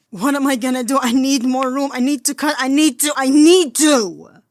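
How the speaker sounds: high, loud desperate cry, slowing on the last words; building anguished wail; half-screamed sobbing. Acted. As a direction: scream out the need through tears. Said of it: "sobbed, and half screamed".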